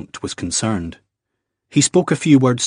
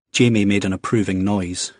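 plain reading voice

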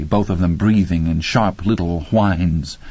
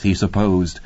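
plain reading voice

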